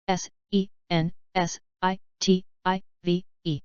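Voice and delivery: slow, letter by letter; unhurried classroom spelling. Shown as a demonstration. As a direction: spell each letter out slowly. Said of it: "spelling drill, slow then fast".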